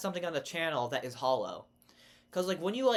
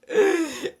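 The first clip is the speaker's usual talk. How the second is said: loud burst of laughter; 14 semitones higher, 11 dB louder than usual. Real, not acted. Laughing at her own pun.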